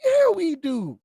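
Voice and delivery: loud, high defiant shout. Real, not acted. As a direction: yell it back defiantly. Shouted answer.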